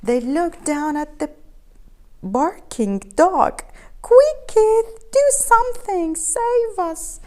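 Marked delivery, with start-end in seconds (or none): 4.0-7.2 high, loud desperate plea; desperate cry. A narrator's reading. Cats in the tree begging Keith for help. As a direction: beg him to save them. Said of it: "they cried".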